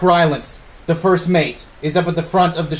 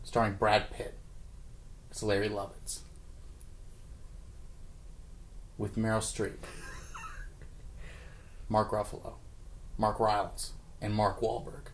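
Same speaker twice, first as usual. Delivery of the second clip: low, soft movie trailer voice; hushed dramatic trailer read; trailer narration; 8 semitones lower, 19 dB quieter than usual. Acted.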